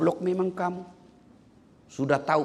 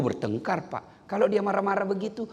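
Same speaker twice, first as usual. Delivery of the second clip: ordinary talk, nothing marked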